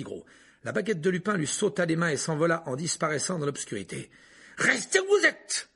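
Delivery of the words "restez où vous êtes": much higher, louder, slow commanding yell; shout. Acted. Harry orders Petit Gros to stay put, shouting. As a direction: order him to freeze. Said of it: "cria Harry".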